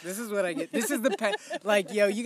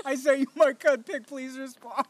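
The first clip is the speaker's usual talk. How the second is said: laughing; 8 semitones higher, 6 dB louder than usual. Pretend. Repeat of mock text, laughing.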